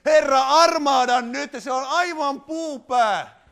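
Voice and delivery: loud, slow, drawn-out plea; mock exasperated prayer. Preacher acting a wife praying about her blockhead husband, loud slow mock plea. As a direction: beg heaven loudly and slowly, mock despair. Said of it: "vaan rukoilen".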